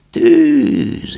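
put-on very high, loud thin old voice; old man's high word. A narrator's reading. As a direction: repeat it like a strange old man. Old man repeats fire. (az öreg magas hangon ismétli)

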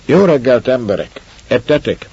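a lord calling down to his men, a touch rough. Acted. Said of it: "Az érdes, reket hangra az úr felelt".

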